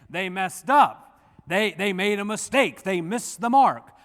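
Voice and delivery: imagined objection. Retold or imitated. A listener in the pews protesting in a mock objecting voice. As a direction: voice the congregation's objection. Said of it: "You might be saying".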